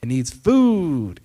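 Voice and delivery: food punched loud and slow; emphatic list. Shown as a demonstration. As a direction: hit the word food, slowly and hard. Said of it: "emphatic list delivery".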